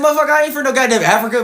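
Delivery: hammed-up furious denial; retold angry denial. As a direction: rattle it off insulted. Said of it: "I said".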